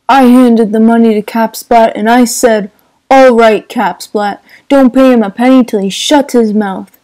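put-on loud, rough old racketeer retelling a payoff; Wolfsheim's voice. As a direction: boast it like a gangster holding court. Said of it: "said Mister Wolfsheim".

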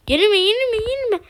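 high frantic repeated plea; urgent pleading. Real, not acted. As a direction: beg the game, rising panic. Begging not to lose.

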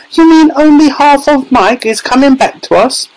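very high horrified shriek; scream of horror. A narrator's reading. Mother hears only half her son returns, horrified shriek. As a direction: shriek the question high, aghast. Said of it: "let out a scream of horror".

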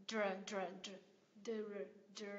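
"Dr" sliding from D into R with a short schwa. Shown as a demonstration. Teacher showing tongue movement, isolated "Dr" blend. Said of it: "mình chuyển ra cái âm R này".

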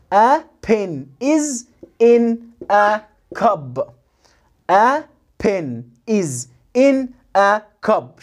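very slow, long gaps between words; slow, stretched model reading; word-by-word sounding out. Shown as a demonstration. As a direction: isolate every word for a beginner. Teacher sounds out sentence word by word.

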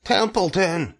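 high-pitched urgent cry; scream. A narrator's reading. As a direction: call the name high, as a contained scream. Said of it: "screamed Wilbur".

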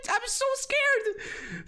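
very high, slow squeaky mock fear; hammed-up tiny scared voice. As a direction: pretend to be terrified. Mock fear after laughing.

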